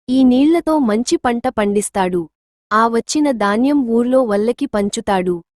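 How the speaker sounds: ordinary delivery, nothing marked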